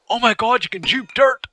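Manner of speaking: lightly hammed-up high excitement; mock forum excitement. Players on the forums, mock-excited. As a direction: play a giddy forum poster, a little. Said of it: "on the forums going".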